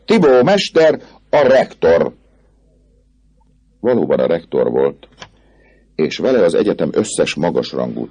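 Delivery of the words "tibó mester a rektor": high, excited identification; raised, emphatic announcement; crowd answering. A narrator's reading. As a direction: call it out for the whole crowd. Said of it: "felelték a többiek".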